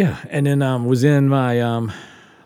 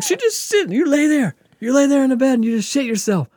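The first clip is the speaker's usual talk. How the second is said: imitating the doctor, much higher and louder, hammering it home; angry doctor ranting; 7 dB louder than usual. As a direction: scold the patient like an outraged doctor. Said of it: "And he's so angry".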